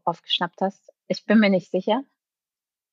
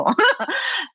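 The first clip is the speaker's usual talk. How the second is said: short, high burst of laughter. Real, not acted. Guest laughing at her own bargaining joke, a high short laugh.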